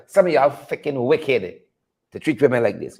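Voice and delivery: a hushed indictment; low condemnation. Real, not acted. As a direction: drop the voice to condemn.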